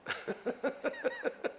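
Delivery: soft, high chuckle. Real, not acted. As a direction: chuckle quietly in a quick run. Laughs at his own example. (작게 킥킥 웃음)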